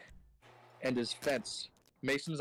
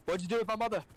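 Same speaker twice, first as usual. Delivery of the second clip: higher-pitched, rough go at South African; attempted South African accent; 8 semitones higher, faster than usual. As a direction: lightly imitate a South African accuser. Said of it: "I can't do a South African accent".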